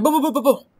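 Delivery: very high, loud booming burst; excited mouth-made gunfire; mock gunfire booms. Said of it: "then we'll be like".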